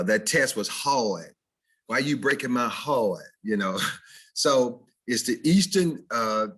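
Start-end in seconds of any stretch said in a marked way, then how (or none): 0.0-3.3 Southern drawl with dropped Rs; stretched Mississippi Southern vowels; Southern accent. Shown as a demonstration. Teacher showing how Southerners talk, Southern accent. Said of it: "Then we got Southern".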